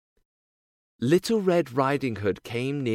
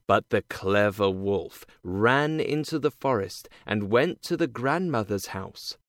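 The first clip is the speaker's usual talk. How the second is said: plain reading voice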